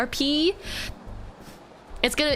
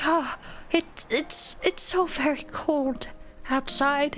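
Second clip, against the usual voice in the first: high, slow, soft shivering waif; mock shivering Victorian child; pitiful cold child; 4 semitones higher, 3 dB quieter, slower than usual. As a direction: whimper it like a freezing orphan. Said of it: "I'll be like".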